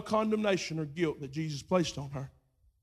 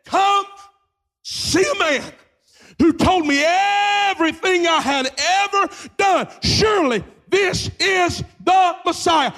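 loud, high shout to the town; calling out; 11 semitones higher, 12 dB louder than usual. Acted. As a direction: cry it out across town. Woman runs calling into town.

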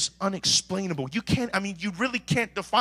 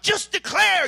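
much higher, louder mock cheerleader; mock pep talk; 6 dB louder, slower than usual. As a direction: parrot the cheery advice. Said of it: "everybody's like".

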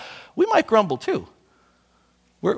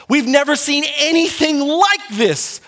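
higher, slow, awestruck exclamation; amazed crowd; 8 semitones higher, 4 dB louder, slower than usual. Retold or imitated. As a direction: gasp it out like a stunned crowd. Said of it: "Mark tells us they say".

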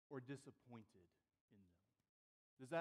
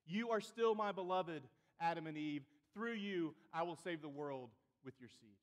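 loud proclamation in God's words; impassioned preaching; 5 semitones higher, 11 dB louder than usual. Acted. Preacher voicing God's commissions and the Psalms, impassioned. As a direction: declaim it boldly, leaning hard on key words.

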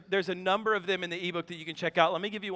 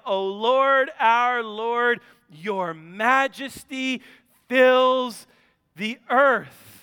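scripture reading; 5 dB louder, much slower than usual. Acted. Reads Psalm eight closing.

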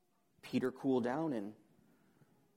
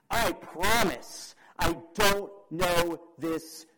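slow, louder sworn denial; Peter's emphatic promise; 3 semitones higher, 7 dB louder, slower than usual. Acted. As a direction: promise it one weighty word at a time. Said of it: "with a promise attached to it".

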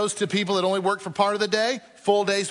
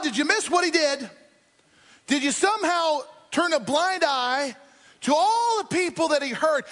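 pitched up, indignant appeal to God; outraged protest; 5 semitones higher than usual. Acted. Believers protesting to God about the forgiven murderer, outraged. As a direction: demand God explain, rising in outrage. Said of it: "And we're like".

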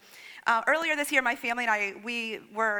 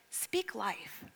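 soft, hushed instruction; lightly voiced inner prompting; Holy Spirit; 2 semitones lower, 8 dB quieter than usual. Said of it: "the Holy Spirit spoke to me".

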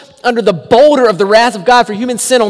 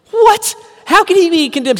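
mock shock; 9 semitones higher than usual. Preacher feigning shock.

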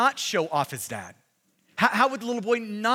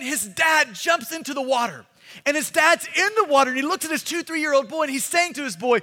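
usual speaking voice

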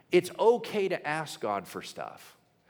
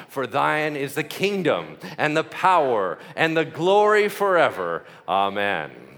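put-on old-school recitation; 7 dB louder than usual. Shown as a demonstration. Preacher leading the prayer's closing part, loud old-school recitation. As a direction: swell through the closing lines, then settle down. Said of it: "we're gonna go a little bit old school".

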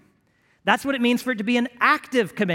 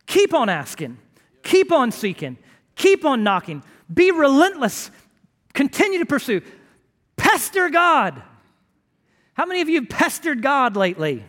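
rising, louder preaching push; fervent preacher pressing the crowd; 4 semitones higher, 5 dB louder than usual. Acted. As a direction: drive it home from the pulpit. Preaches at full force.

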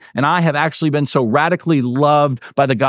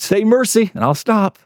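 mock bully taunt; 7 semitones higher than usual. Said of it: "Like that bully on the playground".

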